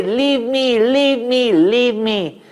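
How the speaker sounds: high, loud cries of leave me; screaming plea. Acted. Fleeing demoness screams at the baby, frantic. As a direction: scream to shake him off. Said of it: "she was screaming away".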